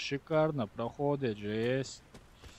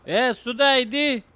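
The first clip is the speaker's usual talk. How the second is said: loud urgent call; shouting for medic; 9 semitones higher, 8 dB louder than usual. Real, not acted. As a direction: yell across the map for the medic. Calling medic in fight.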